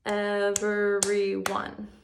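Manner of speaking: very slow, each syllable split apart; clapped syllables. Shown as a demonstration. Teacher clapping syllables of everyone for first graders, slow and split. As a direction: clap out every syllable slowly. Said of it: "let's clap the syllables".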